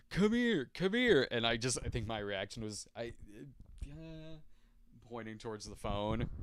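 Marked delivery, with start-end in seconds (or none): 0.1-1.3 beckoning call. Retold or imitated. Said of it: "she's like".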